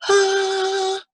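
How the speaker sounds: high hoarse horsey ah. Shown as a demonstration. Teacher shows a horsey voice sound, high and hoarse. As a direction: let out a rough horse-like ah. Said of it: "your voice sounds kind of, like, horsey".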